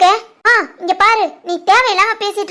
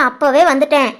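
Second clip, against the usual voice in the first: normal talking voice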